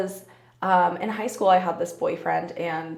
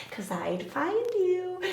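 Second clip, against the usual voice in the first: high, soft, slow movie-villain tease; mock creepy threat; 11 semitones higher, 7 dB quieter, slower than usual. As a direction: draw it out softly in a high sing-song. Creepy movie quote as joke.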